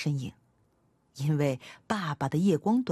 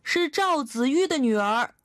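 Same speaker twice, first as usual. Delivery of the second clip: put-on higher, louder child calling out; raised-voice shout; 7 semitones higher, 3 dB louder than usual. A narrator's reading. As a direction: raise your voice so grandfather hears. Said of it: "我提高嗓门喊道".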